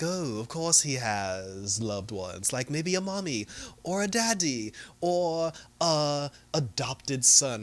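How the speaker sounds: imitated sly, drawn-out taunting; Jared Leto's Joker. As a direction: drag the words out teasingly. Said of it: "Jared Leto shows up, and he's just like".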